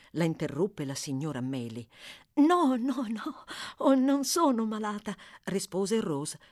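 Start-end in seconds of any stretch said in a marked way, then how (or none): 2.4-5.2 higher and louder, trembling no no no; shivering denial. A narrator's reading. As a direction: insist you're not ill while shivering. (negazione tremante, più acuta e forte)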